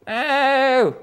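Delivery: high, loud held-out no in a different voice; a second soldier wailing no; another shouted no. Retold or imitated. Another trench soldier refusing mail, long high cry. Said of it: "sometimes they'd go".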